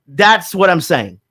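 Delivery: usual speaking voice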